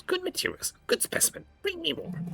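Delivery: high, eager creature snap; snapping homunculus. Acted. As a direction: snap it out like a greedy flesh-crafter. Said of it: "he snaps".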